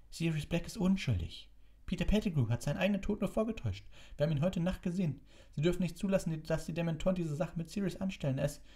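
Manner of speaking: put-on high-pitched, fast, desperate young hero; urgent pleading boy. Figure voice of pleading Harry. (eindringlich flehender Junge, hoch und schnell)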